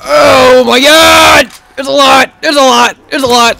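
loud, stretched alarmed cry; real alarm at the horde; alarmed shout. Real, not acted. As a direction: yell at the horde. Horde appears.

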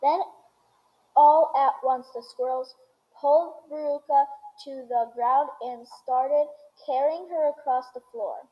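ordinary delivery

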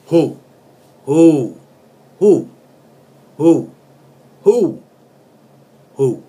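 shouted who, rising in force; hammed-up yell of the song's hook; mock shout. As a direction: bellow the hook like a party cry.

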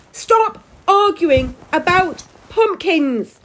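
high, loud, slow shout; furious bellow. A narrator's reading. Giant daughter silences bickering parents, bellowing. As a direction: bellow it word by word. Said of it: "Susie bellowed".

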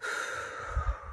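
long, soft sigh out; quiet relaxing exhale; demonstrated exhale. Shown as a demonstration. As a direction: let out a slow, settling breath. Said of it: "Just relax into it with your exhale".

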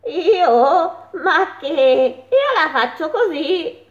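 high goat voice, laughing through it. A narrator's reading. Sleepy goat answering the mole, laughing. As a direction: chuckle it out like a goat.

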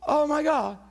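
loud, high acted dismay; horrified realization. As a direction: cry out as guilt hits. Crowd horrified at its guilt.

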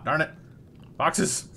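usual speaking voice

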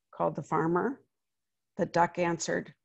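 put-on lively read-aloud voice for children; story narration. A narrator's reading. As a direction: tell it like a picture book to a class. Reads picture book aloud.

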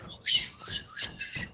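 hushed, voiceless oh-shoot; alarmed whisper. Pretend. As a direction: whisper it as if nearly discovered. Nearly discovered while hiding.

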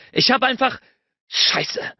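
high burst then a breathy curse; frustrated outburst. A narrator's reading. Bennett gives up explaining, frustrated curse. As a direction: start hot then spit out the curse.

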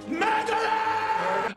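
high, long drawn-out cry of MURDERER; mock accusing yell. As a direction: stretch MURDERER into a hammed-up, high-pitched wail. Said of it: "are yelling at her".

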